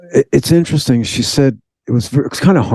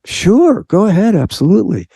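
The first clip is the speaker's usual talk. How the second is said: eager agreement; 9 semitones higher than usual. Retold or imitated. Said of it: "And she said".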